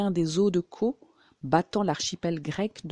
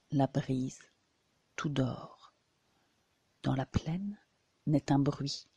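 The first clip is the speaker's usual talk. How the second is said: hushed poetry reading; 2 semitones lower, 4 dB quieter than usual. Shown as a demonstration. Reader setting a sleeping seaside town at night, hushed. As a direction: recite it quietly, as over a sleeping town.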